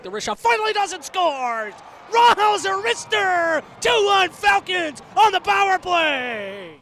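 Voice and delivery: building to a high roaring scores call; play-by-play erupting on the goal. Real, not acted. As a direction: scream the goal.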